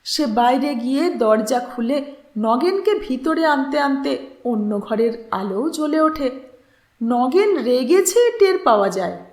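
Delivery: ordinary delivery, nothing marked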